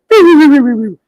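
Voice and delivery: high, loud mock video game beeping; arcade beeps. Comedian imitating the Pac-Man machine, loud high mock beeps.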